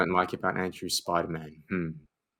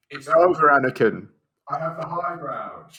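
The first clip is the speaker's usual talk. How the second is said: dramatic film quote; 7 semitones higher, 7 dB louder than usual. Acted. Host revealing the meme award winner, high dramatic cry. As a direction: declaim it as a climactic movie line.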